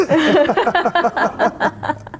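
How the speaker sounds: real high laugh, out loud; high burst of laughter. Real, not acted. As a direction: crack up at the memory. Laughs at own story.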